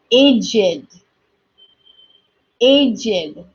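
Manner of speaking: aged said as ay-jid; aged as two syllables. Shown as a demonstration. Teacher drilling aged with class, ay-jid. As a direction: sound Aged with a full id ending. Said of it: "practice with me as I'm saying these words".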